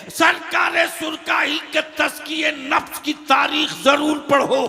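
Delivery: loud, high, ringing sermon delivery; full-force preaching. Real, not acted. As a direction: preach it out to a big crowd. (पूरे जोश में तक़रीर)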